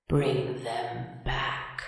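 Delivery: slow, soft, empty voice rising at the end; a drawn-out hollow plea from the grave; hoarse hollow voice. A narrator's reading. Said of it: "hoarse and empty now".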